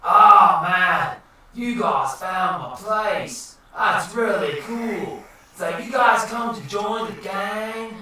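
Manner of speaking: slow, stretched surfer-dude drawl; mellow, chummy cartoon dude; Finding Nemo turtle. Wizard welcomes guys to join the gang, dude drawl. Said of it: "You should be the turtle from".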